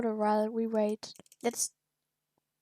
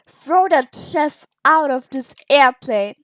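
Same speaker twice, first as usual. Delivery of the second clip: much louder, slow furious command; angry order; 11 dB louder than usual. Acted. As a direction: bellow it like an outraged president. President orders chef thrown out.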